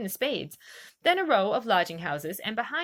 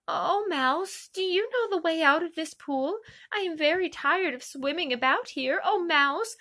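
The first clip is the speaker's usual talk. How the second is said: put-on higher, earnest girl's voice calling to a mouse; a polite little girl trying her best; Alice calling out to the mouse in the pool, earnest; young Alice pleading; 7 semitones higher than usual. A narrator's reading.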